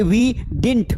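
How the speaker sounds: didn't as one syllable DINT; short-form pronunciation. Shown as a demonstration. Teacher builds negative for class, didn't said DINT. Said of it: "Did not को shortcut में".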